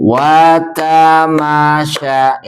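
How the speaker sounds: Watamasya read slowly, syllable by syllable; slow recitation. Shown as a demonstration. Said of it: "Mari baca terlebih dahulu". (membaca Watamasya pelan)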